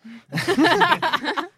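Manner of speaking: sudden squealing laugh; high burst of laughter. Real, not acted. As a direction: laugh out loud, high and bright. GM laughs at smoking joke.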